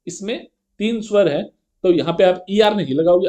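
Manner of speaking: normal talking voice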